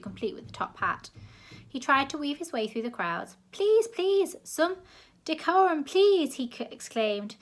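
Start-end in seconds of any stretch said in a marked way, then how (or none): 3.5-6.3 high, loud, fussy posh voice; a plummy official begging for order; posh gentleman. A narrator's reading. Posh zoo director pleading with the crowd, loud and high. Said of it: "so achingly posh".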